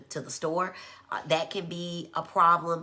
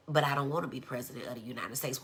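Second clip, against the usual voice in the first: low-key, quick and softer; straight-faced; 4 semitones lower, 5 dB quieter, faster than usual. Retold or imitated. Child correcting mom about being president, straight-faced. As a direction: say it deadpan, low and matter-of-fact. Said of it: "with a very straight face".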